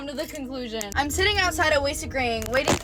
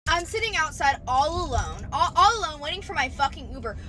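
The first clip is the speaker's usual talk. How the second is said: ordinary talk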